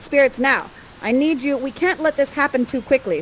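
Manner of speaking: normal talking voice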